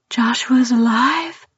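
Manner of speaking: breathless unvoiced whisper. A narrator's reading. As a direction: barely breathe the hope. Stunned, breathless hope.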